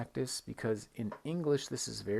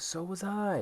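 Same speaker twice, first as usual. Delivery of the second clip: "So was I" swooping much higher at the end; rising "So was I"; 10 semitones higher, 3 dB louder than usual. Shown as a demonstration. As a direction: push "So was I" up high with energy.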